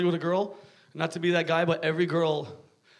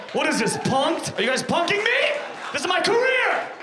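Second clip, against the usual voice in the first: mock panic shout; 8 semitones higher than usual. Real, not acted. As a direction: protest as if being pranked.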